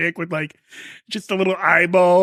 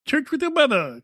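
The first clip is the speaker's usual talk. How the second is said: much higher, mock-shocked; high incredulous; 9 semitones higher than usual. Shown as a demonstration. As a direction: repeat it way up high like disbelief. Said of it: "repeated with raised pitch".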